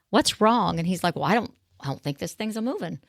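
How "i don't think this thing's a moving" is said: normal talking voice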